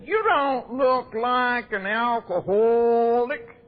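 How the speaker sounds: slow, drawn-out mock of the coffee girls; mimicking the coffee girls. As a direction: mimic the girls' slow disbelieving line, lightly voiced. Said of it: "I'm only mimicking them".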